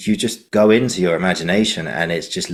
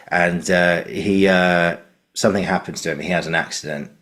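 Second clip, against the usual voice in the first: ordinary delivery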